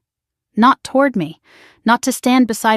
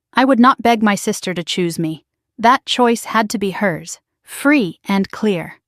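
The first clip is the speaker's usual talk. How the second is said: ordinary delivery, nothing marked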